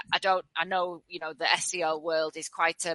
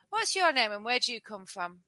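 ordinary talk, nothing marked

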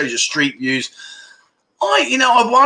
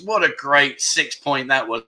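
ordinary delivery

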